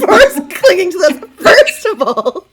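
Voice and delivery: high, loud laugh-broken voice; cracking up mid-sentence. Real, not acted. A player laughs through a protest at the merfolk.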